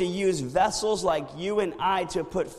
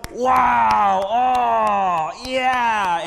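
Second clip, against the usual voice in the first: loud wowing shout; crowd cheering; 6 semitones higher, 11 dB louder, much slower than usual. Retold or imitated. Crowd marvels at fireworks, loud cheering shout. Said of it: "everybody's going".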